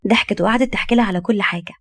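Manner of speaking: ordinary delivery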